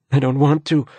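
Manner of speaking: high, scared refusal; frightened. A narrator's reading. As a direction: pull back in fear.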